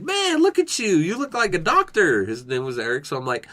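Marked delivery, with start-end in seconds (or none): 0.0-2.2 high-pitched, playful ribbing of a coworker; mock-impressed high voice; teasing exclamation. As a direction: pitch it way up and tease him.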